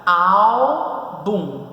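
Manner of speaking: Álbum in two long, loud syllables, ál, bum; split into syllables. Shown as a demonstration. Teacher showing how to separate it, Álbum in slow syllables. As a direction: break Álbum apart, stretching the first syllable. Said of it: "como se separa?".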